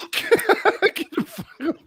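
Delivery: squealing high through laughter; laughing disbelief. Real, not acted. Reacting to the church fights joke.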